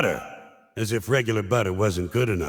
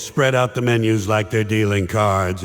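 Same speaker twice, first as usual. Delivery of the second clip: normal talking voice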